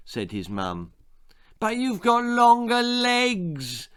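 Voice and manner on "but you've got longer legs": put-on high, slow little-boy whine; whining child. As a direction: whine it like a sulky five-year-old. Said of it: "whined Jack".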